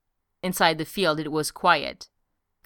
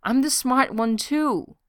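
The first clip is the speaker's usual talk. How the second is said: higher, slower desperate plea; a higher desperate cry; desperately; 7 semitones higher, slower than usual. A narrator's reading. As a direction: plead it high and slow. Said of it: "she said desperately".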